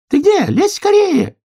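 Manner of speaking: very high, loud squirrel call; squirrel shouting. A narrator's reading. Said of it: "кричала белка из темноты".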